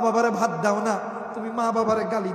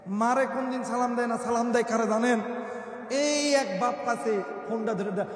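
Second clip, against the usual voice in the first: ordinary delivery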